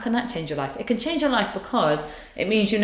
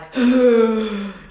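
gasp; 6 semitones higher, 6 dB louder than usual. Retold or imitated. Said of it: "You're right, that's exactly what it is".

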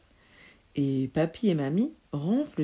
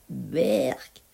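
drawn-out, lower yuck; grimacing disgust; 4 semitones lower than usual. A narrator's reading. Boy grimacing at the witch's recipe, disgusted. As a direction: let the disgust pull the word down. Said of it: "fit Pierre en grimaçant".

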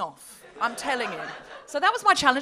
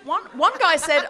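ordinary delivery

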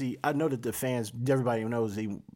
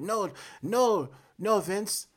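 bad Irish accent, pitched higher; botched Irish brogue that drifts Jamaican; Finn Balor Irish accent; 7 semitones higher than usual. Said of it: "it sucked at Irish".

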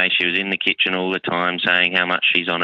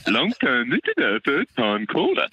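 hammed-up caller greeting; 6 semitones higher than usual. Hams up stock caller greeting.

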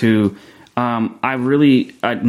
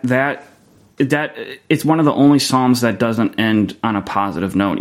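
ordinary talk, nothing marked

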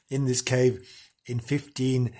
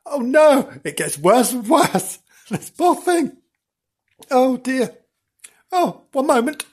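choked up; 9 semitones higher than usual. Real, not acted. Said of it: "I got a bit of the old emotion going".

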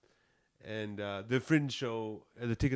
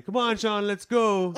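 urging call; 10 semitones higher, 11 dB louder, slower than usual. Retold or imitated. Friends urging Sean to leave.

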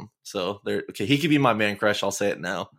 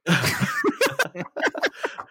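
high, airy burst of laughter; breathless laugh. Real, not acted. Cracking up at the animal violence disclaimer, high airy laugh. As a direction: crack up, no voice left.

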